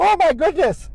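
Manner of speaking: high, loud exclamation of alarm; mock shock. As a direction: imitate a scandalized family gasping out. Family shocked as she passes out.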